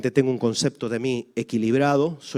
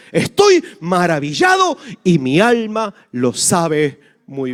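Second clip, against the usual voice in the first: slow, raised, exalted reading of the psalm; solemn psalm reading; 3 semitones higher, 6 dB louder, slower than usual. Acted. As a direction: proclaim the verse slowly and loudly. Salmista quoted in slow exalted reading. (lectura solemne y lenta del salmo)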